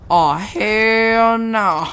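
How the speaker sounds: hammed-up, long-held indignant refusal; mock raging. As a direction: act out the outrage, stretching the words right out. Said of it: "And he starts raging".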